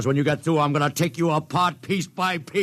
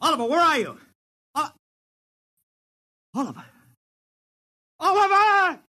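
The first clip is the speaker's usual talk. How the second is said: calling out; 11 semitones higher, faster than usual. Acted. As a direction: shout for Oliver, searching. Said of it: "It is useless to scream at him".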